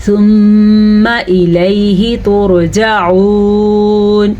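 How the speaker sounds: ترجعون with a full ra and long final "oon"; very slow recitation, long hum on ثم; heavy ra. Shown as a demonstration. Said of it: "را کو پر پڑھنا ہے".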